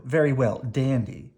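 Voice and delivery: usual speaking voice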